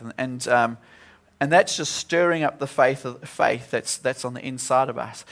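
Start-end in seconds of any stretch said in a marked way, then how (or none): none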